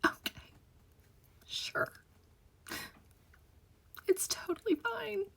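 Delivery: a dazed, whispered okay and forced calm; stunned whisper. Retold or imitated. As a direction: whisper as if nothing were wrong. Stunned reaction to the call.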